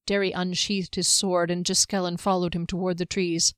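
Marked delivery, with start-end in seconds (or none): none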